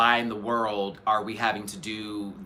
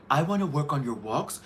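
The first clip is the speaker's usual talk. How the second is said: high, bright runway coach voice; Stacy impression; 7 semitones higher than usual. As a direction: play an upbeat runway coach. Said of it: "Stacy's like".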